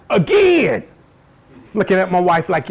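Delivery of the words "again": high-pitched comic exasperation; sudden pitched-up mock dismay; exasperated outburst. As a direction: burst out as if fed up. Acting out waking beside spouse.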